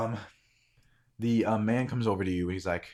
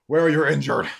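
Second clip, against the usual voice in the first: loud, raised calling voice; urgent demand; 7 semitones higher, 9 dB louder than usual. Acted. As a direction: demand it of wounded riders. Man at drawbridge demands answers.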